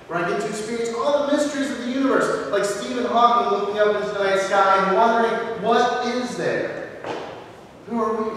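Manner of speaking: lifted, wondering sermon voice; awed preaching. Acted. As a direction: let the wonder of the universe lift the voice.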